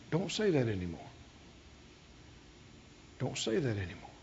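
low, hushed bedside admonition; softly but firmly correct someone frail; quiet, low and steady; gentle correction. Retold or imitated. Gently corrects the weak woman.

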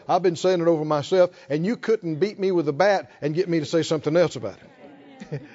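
ordinary delivery

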